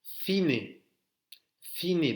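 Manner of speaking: cine said thine, th start; tongue out for the c of cine; Spanish th. Shown as a demonstration. Teacher modelling cine with English th. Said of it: "c'est comme le T H".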